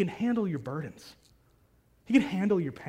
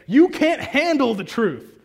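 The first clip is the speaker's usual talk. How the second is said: louder, higher barked movie-colonel line; colonel's retort; 6 semitones higher, 7 dB louder than usual. Retold or imitated. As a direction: throw it back like the furious colonel on the stand. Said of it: "when the colonel is being asked".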